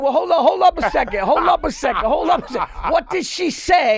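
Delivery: high, loud, hammed-up indignant; mock outraged dude. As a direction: play the guy who can't believe what he heard. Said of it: "there's always that one dude who's like".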